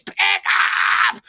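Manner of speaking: very high, loud shriek; piercing kitchen yell; screaming chef. Retold or imitated. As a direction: scream the order like an angry chef. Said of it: "the chef is screaming".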